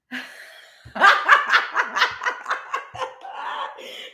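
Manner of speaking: sudden high burst of laughter; big laugh. Real, not acted. Tarot reader cracking up at her own reading, laughing. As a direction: break into a real, high laugh.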